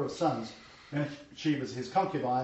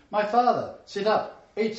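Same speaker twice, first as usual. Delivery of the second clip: calls up high and louder; eager call; 6 semitones higher, 4 dB louder than usual. Acted. Esau calling his blind father awake, eager and louder. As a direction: rouse the old father eagerly.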